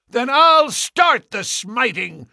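very high, slow, furious retort. A narrator's reading. Ferrier retorting to the departing Mormons, furious. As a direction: hurl it back at them. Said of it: "exclaimed Ferrier furiously".

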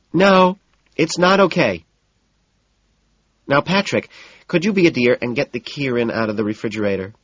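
high, sharp fussy hostess; flustered hostess. A narrator's reading. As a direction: snap then sweeten. Evelyn voiced in narration.

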